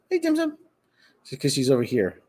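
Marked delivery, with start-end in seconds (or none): 0.1-0.6 pet talk. Real, not acted. Host greets his cat on camera, high cooing. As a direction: coo up high at the kitty.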